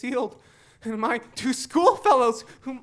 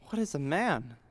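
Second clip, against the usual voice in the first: ordinary talk, nothing marked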